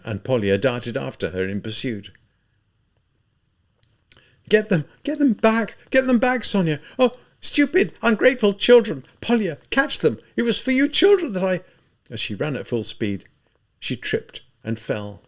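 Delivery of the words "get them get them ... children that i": high, loud desperate calls; frantic calling out. A narrator's reading. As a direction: shout after the runaway children. Mother chasing her children.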